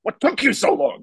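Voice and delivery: imitating an irritated, stressed-out father; mildly acted impatient outburst; snapping at son. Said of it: "shoots at his son".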